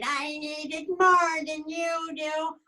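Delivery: put-on high, slow old-lady voice. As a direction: refuse him like a frail old woman. Old lady refusing Tony.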